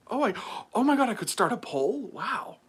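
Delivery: high, loud amazement; surprised. Real, not acted. Streamer discovering they could start a poll, loud surprise. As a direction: burst out at a sudden discovery.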